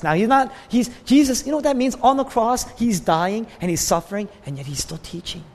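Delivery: ordinary delivery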